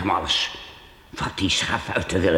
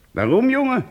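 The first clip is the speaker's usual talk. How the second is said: much higher, startled; surprised, much higher asking why; surprised question. Acted. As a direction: jump up in surprise. Priest surprised by boy's request.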